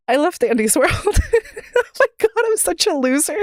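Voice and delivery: high voice breaking into sobbing laughs; real tearful crying. Real, not acted. Streamer opens up to viewers about a loved game, tearful. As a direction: choke up and laugh through the tears. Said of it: "I'm gonna cry".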